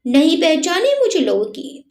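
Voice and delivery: plain reading voice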